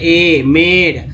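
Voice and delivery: मेड with a drawn-out ay vowel; slow teacher's demo of मेड. Shown as a demonstration. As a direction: say the vowel ay slowly, then मेड. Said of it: "मेड में ए को क्या बोल रहे हैं".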